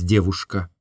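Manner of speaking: plain reading voice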